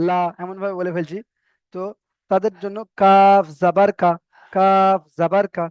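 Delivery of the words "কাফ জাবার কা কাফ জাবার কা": slow repeated spelling. Shown as a demonstration. Teacher drilling students who forget, slowly. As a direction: say কাফ জাবার কা slowly, each syllable apart. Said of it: "তিনবার করে বলে".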